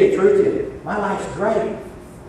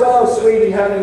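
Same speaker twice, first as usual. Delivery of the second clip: ordinary talk, nothing marked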